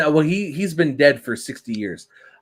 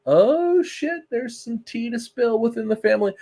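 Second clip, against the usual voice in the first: mock shocked gasp; 10 semitones higher than usual. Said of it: "you're like".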